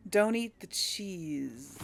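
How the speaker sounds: usual speaking voice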